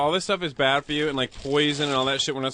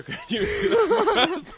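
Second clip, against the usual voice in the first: laughing; much slower than usual. Real, not acted. Laughing request to producer.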